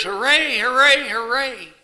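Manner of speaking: loud, high victory cheer. Acted. Dad cheering his team's championship win, loud and high. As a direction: cheer like your team just won. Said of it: "Dad jumps up and cheers".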